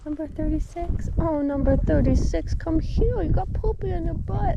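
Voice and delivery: very high cooing, rising. Real, not acted. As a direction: call the little animal over sweetly. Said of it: "Don't mind my baby talk".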